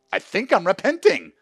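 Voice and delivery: "I think I'm repenting" cried out high and loud, stress on "I'm"; emotional loud confession. Retold or imitated. Husband telling his wife, loudly overcome. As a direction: blurt the confession through tears. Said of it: "I go".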